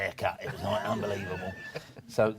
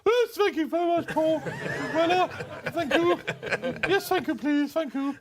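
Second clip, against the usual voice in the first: much higher and louder, giggly and flustered; starstruck younger self; 6 dB louder than usual. Retold or imitated. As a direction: play your dazzled younger self taking a famous call. Gushing thanks to [name] on phone.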